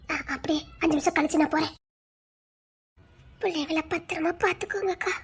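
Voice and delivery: very soft, tearful undertone; hushed farewell. Acted. Entrusting the children to elder sister, hushed and tearful.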